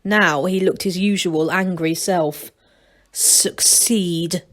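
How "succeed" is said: hissed. A narrator's reading. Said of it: "he hissed".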